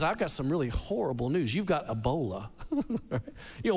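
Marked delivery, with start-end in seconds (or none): 0.0-2.5 doctor with grave news. Retold or imitated. Doctor voiced in story.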